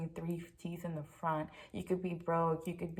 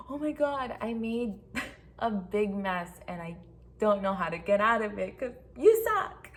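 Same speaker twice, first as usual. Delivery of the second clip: mock flustered husband; 3 semitones higher, 7 dB louder than usual. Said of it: "It's just he's like".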